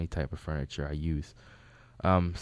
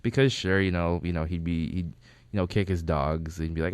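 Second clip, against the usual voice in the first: normal talking voice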